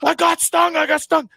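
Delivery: high, frantic panicked cry. Retold or imitated. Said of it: "He's like".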